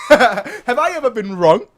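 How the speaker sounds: slow, laughing and loud; laughing boast. Real, not acted. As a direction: crow it through laughter.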